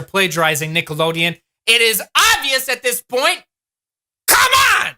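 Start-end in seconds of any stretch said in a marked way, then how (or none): 4.3-4.9 high, loud, fed-up outburst; exasperated cry. Real, not acted. Calling out the show for copying Nickelodeon, exasperated shout. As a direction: throw up your hands and yell it.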